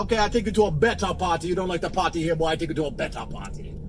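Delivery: imitated Jamaican patter; Jamaican accent. Friend offers a better party, in a Jamaican accent. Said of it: "W Andrew Tate's Jamaican accent".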